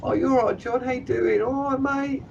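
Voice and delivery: high, chirpy mock greeting; mock the false chumminess; high sing-song imitation of a fake friend; fake-friendly mimic. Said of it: "they come and go".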